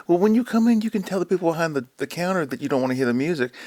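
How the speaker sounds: mocking receptionist.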